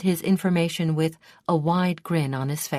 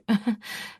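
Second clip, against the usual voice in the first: a short amused laugh, pitched up; light laugh; 6 semitones higher than usual. A narrator's reading. Daughter laughs off her father's offer with a light laugh. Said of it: "she laughed".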